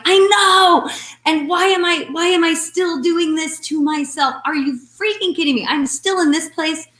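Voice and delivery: mock outrage at her own old habits; exasperated at herself. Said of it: "And I'm like".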